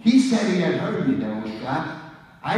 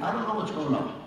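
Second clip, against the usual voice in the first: normal talking voice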